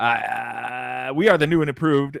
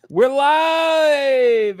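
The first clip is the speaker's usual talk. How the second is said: excited; 11 semitones higher, 6 dB louder than usual. Real, not acted. Host echoes live opening.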